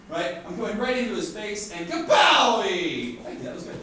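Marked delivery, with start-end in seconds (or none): 0.5-3.2 slowed, louder build to a shouted kapowie; slow motion punch. Pretend. Teacher acting out a punch to a volunteer in slow motion. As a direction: drag it out like a slow-motion replay. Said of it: "slow motion style".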